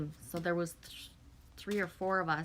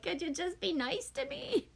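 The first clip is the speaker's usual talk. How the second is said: put-on whiny child plea; 10 semitones higher than usual. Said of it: "it's like".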